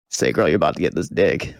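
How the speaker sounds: deep bass voice; lowest voice. Shown as a demonstration. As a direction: drop to the very bottom of your range. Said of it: "one more time, really low".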